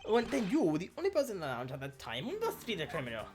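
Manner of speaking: put-on high, confident French accent. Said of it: "too hard into the accent".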